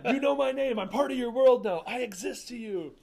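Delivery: high, loud, laughing starstruck gush; starstruck fan. Retold or imitated. Host voicing the starstruck fan, giddy loud gush. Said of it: "acting starstruck fan".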